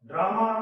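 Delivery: "ड्रामा" pushed out loud with a long "aa"; loud, reluctant teaching demo of "ड्रामा"; forced drama. Shown as a demonstration. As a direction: say "ड्रामा" as if someone is forcing it out of you. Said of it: "हमसे जबरदस्ती बुलाया जा रहा है".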